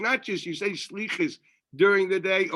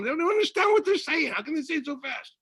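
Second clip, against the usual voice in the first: exasperated outburst; 9 semitones higher than usual. Real, not acted. Exasperated complaint about fast Slichas.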